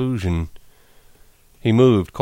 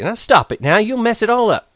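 angry; 13 semitones higher, 5 dB louder than usual. A narrator's reading. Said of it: "she cried angrily".

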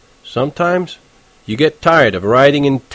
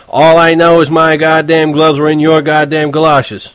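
defiant retort; 5 semitones higher, 5 dB louder than usual. Retold or imitated. Imagined confrontation with glove thief.

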